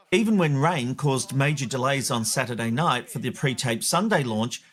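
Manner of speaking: usual speaking voice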